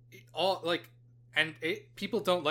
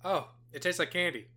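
ordinary delivery

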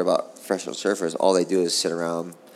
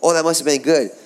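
mock judges; 8 semitones higher, 3 dB louder than usual. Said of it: "they went".

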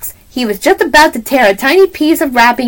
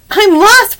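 usual speaking voice

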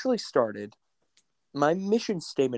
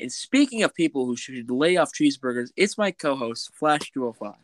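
normal talking voice

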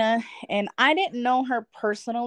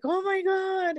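mock amazement; 11 semitones higher, slower than usual. Best friend reacts to dream.

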